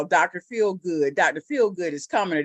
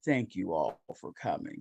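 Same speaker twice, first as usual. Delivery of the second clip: very low, smooth and seductive; sexy deep voice; 11 semitones lower, 10 dB quieter than usual. Shown as a demonstration. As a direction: drop way down into a sultry voice. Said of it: "I can switch up like that".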